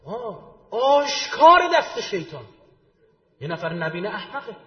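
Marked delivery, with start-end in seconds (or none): 0.0-2.5 high, loud, slow hammed-up sermon tone; mock pious official. Parodying officials who blame the devil, hammed-up and loud. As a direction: ham up a preacher declaring the devil's hand.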